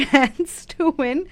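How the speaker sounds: ordinary talk, nothing marked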